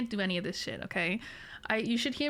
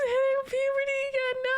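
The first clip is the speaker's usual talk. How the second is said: high squealing laughter; laughing; 3 dB louder, faster than usual. Real, not acted.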